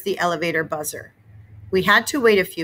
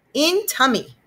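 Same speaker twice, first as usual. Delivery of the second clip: put-on high little-boy voice; cheerful small child; toddler voice; 8 semitones higher than usual. A narrator's reading. Fudge answering mom, high toddler voice.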